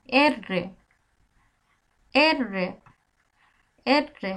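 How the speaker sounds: এররে with a trilled double r; এররে, long trilled rr; rolled R. Shown as a demonstration. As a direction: roll the r in এররে. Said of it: "দুইটা আর এক জায়গায়".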